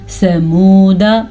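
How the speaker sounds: "سمودہ" with long ū and short da; waw madd. Shown as a demonstration. Teacher showing waw maddah, "سمودہ" held on ū. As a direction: stretch the ū only in "سمودہ". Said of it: "دال کو لمبا نہیں کریں گے".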